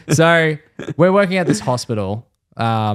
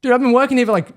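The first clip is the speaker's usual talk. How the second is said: high, exasperated comeback; retold heated objection; indignant protest; 11 semitones higher, 4 dB louder, much faster than usual. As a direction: argue back in self-defence. Apprentice arguing back at the supervisor.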